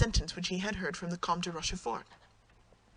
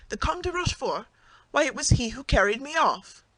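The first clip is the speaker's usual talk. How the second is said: higher and louder, aghast; shocked outcry; 6 semitones higher, 6 dB louder than usual. Acted. Wife, aghast, learns her abductor paid her husband, shocked outcry. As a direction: cry out in shocked outrage.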